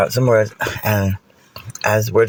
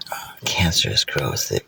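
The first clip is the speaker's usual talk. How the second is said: whispering; 11 semitones lower, 6 dB quieter than usual. Acted. Aside dropped in story.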